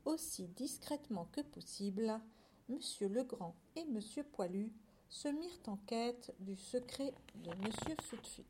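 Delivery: soft, secretive narration; hushed storytelling. A narrator's reading. Narrator following farmers on the sly, hushed. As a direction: sink towards a whisper at the secret.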